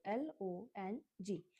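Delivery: normal talking voice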